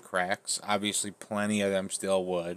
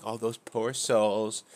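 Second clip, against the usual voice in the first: slow, drawn-out mock pity; imitating a patronizing sympathizer; slower than usual. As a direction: put on condescending sympathy, stretching the words. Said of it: "like, patronizing them".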